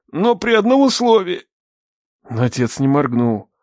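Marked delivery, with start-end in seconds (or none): 0.1-1.5 high-pitched plea for terms; desperate bargaining. A narrator's reading. Captive sets a condition to the armed father, desperate.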